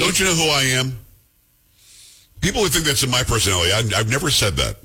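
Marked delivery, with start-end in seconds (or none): 0.0-1.0 mock entitled. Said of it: "all you have to say is".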